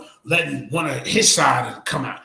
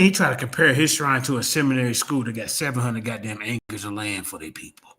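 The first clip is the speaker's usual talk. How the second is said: normal talking voice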